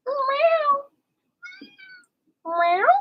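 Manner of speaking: high, squeaky mock meows; playful kitty meow; cat meow. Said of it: "Kitty Cat says".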